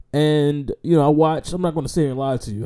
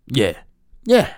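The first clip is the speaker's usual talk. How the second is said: normal talking voice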